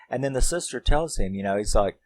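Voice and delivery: normal talking voice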